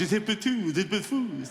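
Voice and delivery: mock slangy old man; crazy old man lingo. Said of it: "I have my own language".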